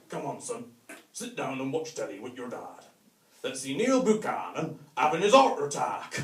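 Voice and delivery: a Bristol dad settling in for telly, lightly voiced; Bristolian dad. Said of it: "some Bristolian dad".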